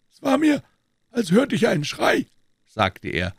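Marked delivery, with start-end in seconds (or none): 0.1-2.3 a lightly voiced doctor, a little higher; a hint of a composed doctor; the doctor explaining why he came in, lightly voiced. A narrator's reading. Doctor speaking in the story.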